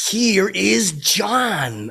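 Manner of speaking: high, drawn-out announcer call. Retold or imitated. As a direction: stretch it out like a talk-show announcer. Announcing Johnny Carson, Ed McMahon style.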